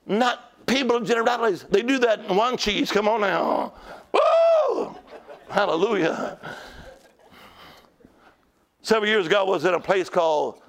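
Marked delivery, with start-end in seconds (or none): 4.1-5.0 very high, drawn-out "woo"; exultant whoop. Real, not acted. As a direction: let out a revival-meeting whoop. Preacher whoops mid-sermon.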